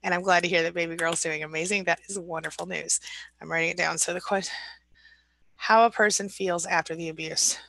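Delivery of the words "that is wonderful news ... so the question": ordinary talk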